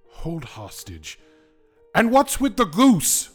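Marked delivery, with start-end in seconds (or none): 1.9-3.3 much higher, loud outburst. Acted. Smiley Fang berates the whim, a loud exasperated outburst. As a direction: burst out in disbelief.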